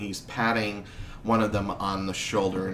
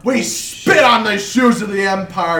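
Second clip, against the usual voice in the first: much higher, loud, slow furious shouting; furious crowd; 11 semitones higher, 9 dB louder, slower than usual. Acted. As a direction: spit the insults at the duke. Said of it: "the crowd just says".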